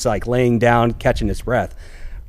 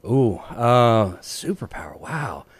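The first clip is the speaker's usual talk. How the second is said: hushed musing; 5 dB quieter, slower than usual. Real, not acted. Thinking aloud softly.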